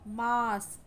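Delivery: "मास" with a drawn-out aah; teacher's long-vowel "मास". Shown as a demonstration. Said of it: "बहुत ही long sound".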